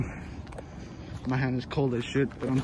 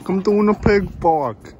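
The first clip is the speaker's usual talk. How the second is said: much higher, much louder yell; loud taunting shout; 7 semitones higher, 8 dB louder than usual. Acted. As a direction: bellow the taunt across the field.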